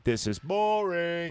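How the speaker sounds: slow, drawn-out bored whine; exaggerated bored complaint; mock boredom. Mocks boring show, drawn out.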